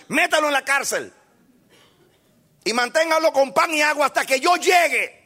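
high, loud commanding shout; angry order. Retold or imitated. King ordering the prophet jailed, angry and loud. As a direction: bark the order to the guards. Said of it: "Y se enoja y da una orden".

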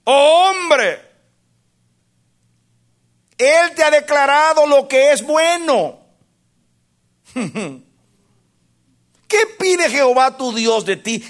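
a high, loud voice of God calling to man; God's reply to man, lightly voiced and lifted; God answering. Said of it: "Dios responde".

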